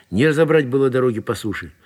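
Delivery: plain reading voice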